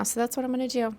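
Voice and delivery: normal talking voice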